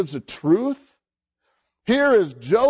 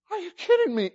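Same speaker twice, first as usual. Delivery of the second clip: incredulous; 10 semitones higher, much faster than usual. Real, not acted. Preacher exclaiming in disbelief.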